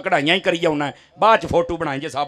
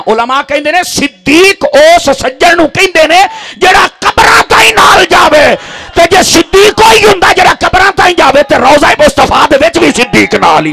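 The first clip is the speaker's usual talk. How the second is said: high, loud shouted preaching; fiery sermon climax; 10 semitones higher, 10 dB louder than usual. Acted. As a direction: drive the crowd up at full force.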